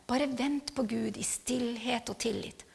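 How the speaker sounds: soft, slow whisper of the verse; whispered scripture. Shown as a demonstration. Speaker to congregation, whispering the verse on waiting in silence. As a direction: breathe the words out hushed and slow.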